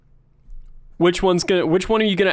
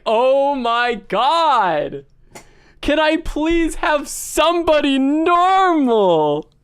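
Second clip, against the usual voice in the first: high, drawn-out mock despair; exasperated plea; 9 semitones higher, much slower than usual. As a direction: beg in hammed-up exasperation. Exasperated by crying coworker.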